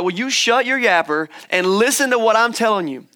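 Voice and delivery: hammed-up scolding to make them listen; God's mock scolding. Voices God scolding a talkative person.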